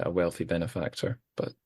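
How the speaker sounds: usual speaking voice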